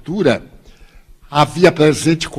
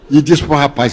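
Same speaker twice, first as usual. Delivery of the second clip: ordinary talk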